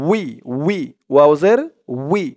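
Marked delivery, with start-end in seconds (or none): none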